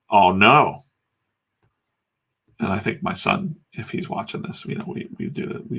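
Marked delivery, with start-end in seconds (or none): 0.1-0.8 mock dismay. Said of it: "And I'd be like".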